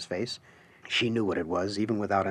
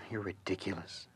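much softer, tired put-down; weary dismissal; 3 semitones lower, 14 dB quieter than usual. Acted. Alice rolling her eyes at Everett, soft weary dismissal. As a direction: sigh it at him, done with it. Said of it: "Alice rolled her pretty eyes heavenward".

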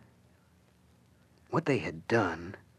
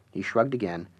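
usual speaking voice

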